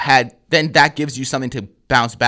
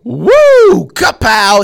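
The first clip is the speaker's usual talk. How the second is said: loud, high victory holler; explosive, showy celebration; triumphant whoop; 12 semitones higher, 10 dB louder, much slower than usual. Retold or imitated. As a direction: re-enact crowing over a heckler. Acts out triumph over the heckler.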